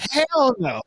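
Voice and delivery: high, emphatic refusal. Real, not acted. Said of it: "Can we hear it?".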